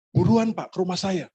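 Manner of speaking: high-pitched woman caller pleading for haste; acting out an urgent phone plea. Phone caller urging pastor to come.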